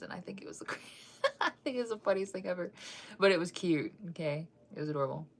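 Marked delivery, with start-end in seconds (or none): 0.0-2.7 laughing. Real, not acted. Laughing at a funny memory.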